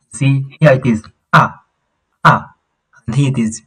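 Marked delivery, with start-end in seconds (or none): 1.3-2.5 'ah' short and falling. Shown as a demonstration. Teacher breaks down the falling tone, short falling 'ah'. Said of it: "You see the falling tone".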